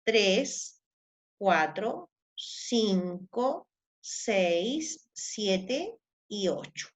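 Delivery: slow, low counting of each syllable. Shown as a demonstration. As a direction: count them out one by one, slowly. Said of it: "Si yo cuento aquí". (contando sílabas despacio, en voz baja)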